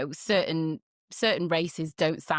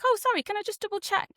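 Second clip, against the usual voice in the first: high-pitched imitation of a hesitant caller; mock polite query; 14 semitones higher, 3 dB louder, faster than usual. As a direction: imitate an apologetic double-check, pitched high. Retold caller questioning her stated ethnicity.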